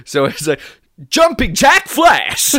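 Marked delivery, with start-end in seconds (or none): 1.1-2.5 high, loud, slow fake American; Brit faking American. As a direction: belt out a badly faked American accent. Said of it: "a British person trying to do an American voice".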